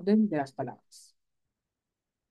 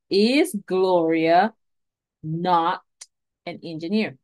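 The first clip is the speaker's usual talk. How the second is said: "Is Gloria not an engineer" drawn out word by word; slow model question; much slower than usual. Shown as a demonstration. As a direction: read "Is Gloria" very slowly, then "not" on its own. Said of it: "pero también negativas".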